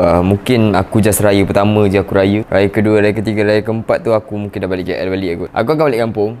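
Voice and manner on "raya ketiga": normal talking voice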